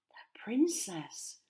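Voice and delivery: slow, breathy whispered awe. A narrator's reading. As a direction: hush it out slowly, barely breathing. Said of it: "Hex whispered to Fire".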